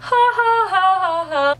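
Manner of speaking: hammed-up cackle; mock laugh. Mocking laugh after the Suriel's line.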